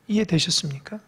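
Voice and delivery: hushed whispered question. Real, not acted. Pastor checking with the congregation in a whisper. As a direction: lean in and whisper it.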